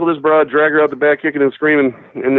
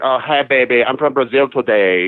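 slow put-on Brazilian accent, imitated stripper; mock Brazilian lilt, drawn out; fake Brazilian accent. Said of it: "like, fake accent".

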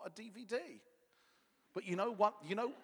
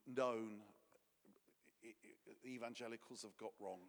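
usual speaking voice

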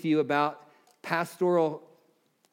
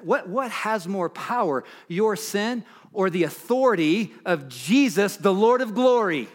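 raised, ringing pulpit voice, pitched higher; fervent preaching; 6 semitones higher, 5 dB louder than usual. Acted. Pastor urging his church to speak the word, fervent preaching. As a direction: preach it out to the whole room.

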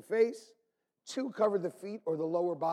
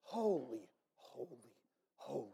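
hushed; 7 semitones lower, 11 dB quieter than usual. Acted. Hushed echo after shout.

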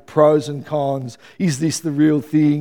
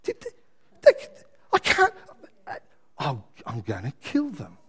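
incredulous churchgoer; 2 semitones lower, 6 dB quieter than usual. Retold or imitated. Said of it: "it's like".

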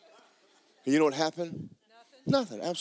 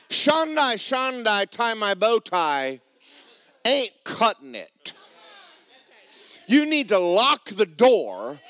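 ordinary talk, nothing marked

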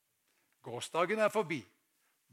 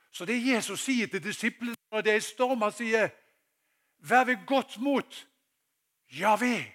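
loud, high preaching voice; forkynnelse for full kraft; 8 semitones higher, 9 dB louder than usual. Acted. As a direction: proclaim it to the whole hall, drawing out the key words. (kraftfull forkynnerstemme)

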